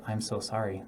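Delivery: quiet, gentle and low; soft sympathy. Shown as a demonstration. Agent comforting a grieving client, soft and sincere. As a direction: say it softly, like you mean it. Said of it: "And you can say".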